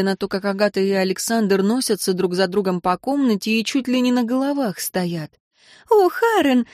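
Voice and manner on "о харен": high, exclaimed greeting; exclaiming. A narrator's reading. Flushed wife greets her husband stepping from the fireplace, exclaiming. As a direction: cry out as the husband walks in. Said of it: "воскликнула ярко-малиновая Виктория".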